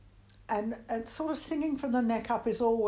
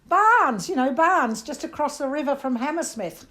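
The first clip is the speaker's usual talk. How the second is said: "Barnes" repeated high and loud in an Australian twang; twangy Australian insistence; 6 semitones higher, 9 dB louder than usual. Retold or imitated. [name] repeats her suburb to a puzzled Londoner in Australian twang. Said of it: "My R vowel had masses of twang".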